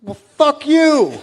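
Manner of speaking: hammed-up defiant comeback; mock angry retort. Speaker firing back at Tony Atlas, mock anger.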